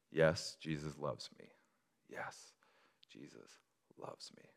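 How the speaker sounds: refrain dropping to a near breath; hushed hymn refrain. Shown as a demonstration. Pastor softly finishing the children's hymn. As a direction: let it fall away to almost nothing. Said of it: "spoken word this thing".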